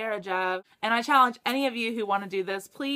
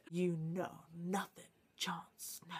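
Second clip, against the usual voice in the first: breathy whisper of a famous line; soft, slow teasing whisper; whispered quote; 4 semitones lower, 12 dB quieter, slower than usual. Retold or imitated. Quoting Ygritte's line.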